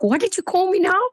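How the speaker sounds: high, fast, startled question; asking her boyfriend in retold shock, high and quick. Scene: she asks her boyfriend what he called her, shocked.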